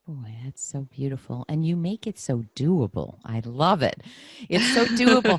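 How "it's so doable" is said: high, loud burst of delight; excited echo. Real, not acted. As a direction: throw the words up high, thrilled. Echoing guest's words with delight.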